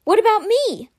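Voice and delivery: high, plaintive little character voice; small voice. A narrator's reading. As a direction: pipe up loudly from far back on the ship. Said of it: "followed by a small voice".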